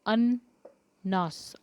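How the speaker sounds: अननास drawn out very slowly syllable by syllable; slow spelling-out. Shown as a demonstration. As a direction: stretch अननास out, अ-न-ना-स. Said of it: "ये आपके शब्द है".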